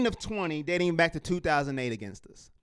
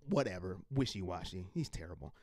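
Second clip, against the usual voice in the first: worn-out mutter; 14 dB quieter than usual. Real, not acted. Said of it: "I'm tired of Jabril Peppers".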